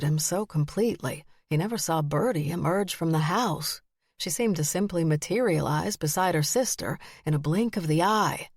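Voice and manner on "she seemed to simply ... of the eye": usual speaking voice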